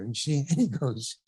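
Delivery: ordinary delivery, nothing marked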